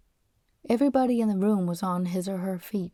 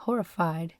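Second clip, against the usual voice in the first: plain reading voice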